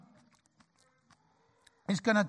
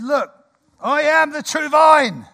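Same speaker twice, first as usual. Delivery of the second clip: loud, slow West Country farmer; West Country accent; 7 semitones higher, 10 dB louder, slower than usual. Acted. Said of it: "Of my best West Country accent".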